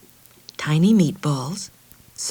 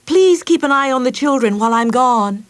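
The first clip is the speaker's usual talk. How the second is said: louder, higher call across the house; 6 semitones higher, 7 dB louder than usual. Acted. Mother calls to Alva on her way out, calling. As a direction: call out while leaving. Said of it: "she called to Alva".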